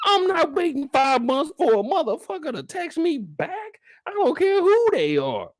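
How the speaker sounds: ranting about people who leave him on read; fired-up rant. Real, not acted. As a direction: refuse to wait, fully heated. Ranting about unanswered texts.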